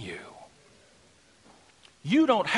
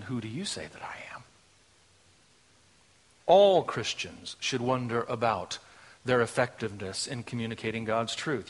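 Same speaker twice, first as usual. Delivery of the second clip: low, soft, solemn pulpit voice; hushed preaching; 6 semitones lower, 3 dB quieter than usual. Real, not acted. Preacher asking dear friends who Jesus is, hushed preaching. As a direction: drop right down and let it sink in.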